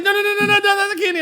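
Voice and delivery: high, frantic no, no, no; pleading wife. Retold or imitated. Wife begs husband to spare the candy, high and frantic. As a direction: squeak it out as if saving the candy. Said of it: "she was like".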